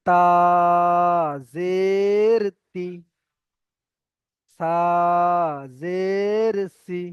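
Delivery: long held letter and zer, short final syllable; Qaida zer recitation. Shown as a demonstration. Teacher spells letters with zer for learners, slow measured chant. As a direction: chant slowly, stretching names, not the ending. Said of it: "কোনো টান হবে না".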